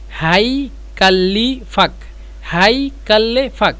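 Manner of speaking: হাইকাল্লিফাক drawn out slowly, syllable by syllable; slow teaching demo. Shown as a demonstration. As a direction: spell out হাইকাল্লিফাক slowly for learners. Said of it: "এভাবেই".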